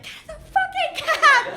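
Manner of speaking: high, loud laughing shout; mock yell. Daughter cursing mom into the cab, laughing yell. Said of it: "I just start cursing".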